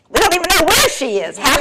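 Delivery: high, fast mock-hysterical protest; exasperated outburst. Wife answering husband's calm advice, exasperated screech. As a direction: explode in disbelief, hammed up.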